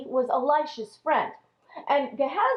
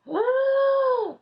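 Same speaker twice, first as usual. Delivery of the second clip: gasp; 12 semitones higher, 4 dB louder than usual. Acted. King reads the alarming letter.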